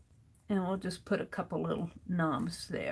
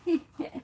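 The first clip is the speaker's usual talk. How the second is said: giggle; 9 semitones higher than usual. Real, not acted. Sneezing online, sanitizer.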